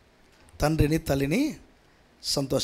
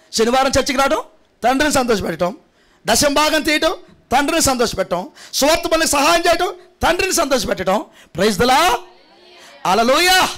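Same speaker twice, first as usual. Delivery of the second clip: rising, high, loud preaching build; full-force preaching; 8 semitones higher, 3 dB louder than usual. Acted. Pastor rousing the congregation, building to a shouting preach. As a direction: preach it higher and louder, line by line.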